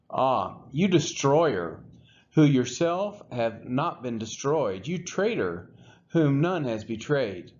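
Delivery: usual speaking voice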